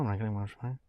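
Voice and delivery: barely holding on; fading into tears; choked whisper. Real, not acted.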